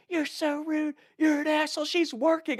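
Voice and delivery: whiny falsetto impression of outraged girls; imitated screaming. Dock girls berate the man climbing up, high mock screech. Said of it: "the other dock girls screaming".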